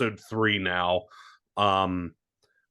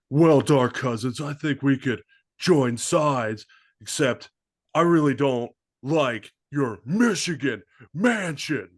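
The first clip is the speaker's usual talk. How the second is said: louder, higher eccentric movie-star voice; Nicolas Cage impression; 5 semitones higher, 4 dB louder than usual. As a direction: do an over-the-top Nic Cage. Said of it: "Nicky Cage is, you know, just out there like".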